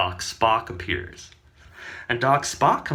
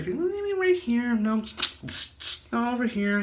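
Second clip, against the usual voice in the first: high voice; 11 semitones higher than usual. Acted. Doc positioning jar.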